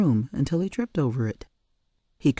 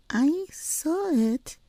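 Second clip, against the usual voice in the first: put-on high, slow child's voice; calm, certain; little girl insisting; much slower than usual. A narrator's reading.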